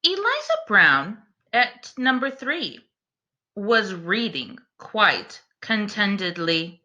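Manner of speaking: slow, lilting bedtime rhyme reading; story narration. A narrator's reading. Reading a rhyming bedtime book to the class, gentle storyteller voice. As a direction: read it like a picture book to little ones.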